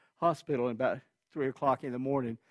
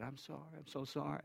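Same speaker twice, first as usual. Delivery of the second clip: much softer, faint dying man's voice; weak apology; 2 semitones higher, 14 dB quieter than usual. Retold or imitated. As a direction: barely push the words out. Dying friend apologising weakly.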